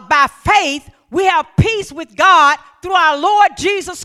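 loud, rising whoop of a preacher in full flight; preaching at full force. Acted. Pastor whipping up the church with faith, full-force preaching. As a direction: preach it hard to the congregation.

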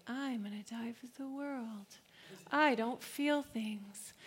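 softly voiced, emotionless robot. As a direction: lightly imitate a robot going through the motions. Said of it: "just kinda like a robot".

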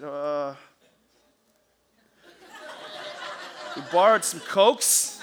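hesitant mock excuse, slow and swelling; stall, then draw out a guilty half-truth; sheepish confession. Boy facing inn manager, mock sheepish.

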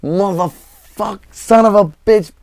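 high, strained cursing fit, imitating an enraged singer; retold furious tirade. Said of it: "comes storming into that club".

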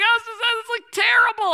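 hammed-up high, loud blubbering; mock bawling. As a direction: stammer it out in a squealing mock sob. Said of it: "I started bawling".

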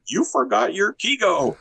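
mock a fussy teacher's tut-tut; finger-wagging critic. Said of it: "a finger wagging at them saying".

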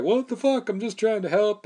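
high-pitched, exasperated mock protest; indignant protest. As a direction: imitate a bewildered guy pleading his innocence. Acts out punched bouncer's protest.

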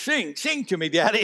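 put-on high, small child's voice asking; child's plea. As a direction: voice the little boy pleading sweetly. Pastor voices his little son.

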